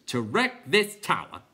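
announcing clown. A narrator's reading. Clown informing the others, slow loud announcement. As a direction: spell it out for the crowd.

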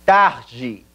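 TARDE as "TAH-jee", breathed h for r; Portuguese pronunciation. Shown as a demonstration. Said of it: "Pasemos entonces al ejercicio del backtracking".

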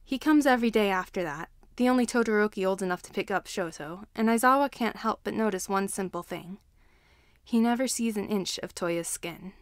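ordinary delivery, nothing marked

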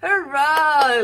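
high, loud hurrah; cheer. Acted. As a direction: cheer the kids on. Cheer after the song.

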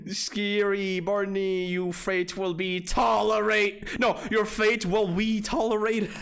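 hammed-up villain decree; dramatic villain read. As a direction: boom it out like a doom announcer. Dramatic reading of game text.

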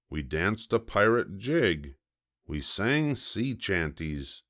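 ordinary delivery, nothing marked

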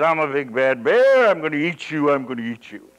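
loud mock monster roar; playful bear roar. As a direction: growl at the child for fun. Grandfather closes in as bear.

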